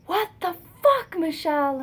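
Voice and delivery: high, loud imitation of an outraged mom; mom's angry outburst. Mom calls her daughter after she messed up, angry outburst.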